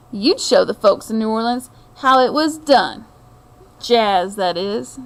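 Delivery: unhurried storybook reading voice; story narration. A narrator's reading. Reading a picture book to viewers, storyteller's narration. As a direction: draw the listener into a tale of old New Orleans.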